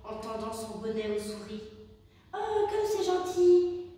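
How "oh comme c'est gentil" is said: high, slow, louder squeaky mice chorus; grateful mice. A narrator's reading. Little mice thanking the hedgehog for his hat, touched and squeaky. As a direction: coo it slowly like tiny touched mice. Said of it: "dirent-elles".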